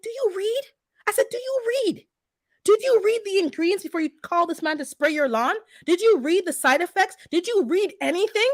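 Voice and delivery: high, pressing rapid questions; scolding a neighbour about the lawn spraying; exasperated yelling. Retold or imitated. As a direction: grill her with one angry question after another. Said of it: "after I was yelling for a bit".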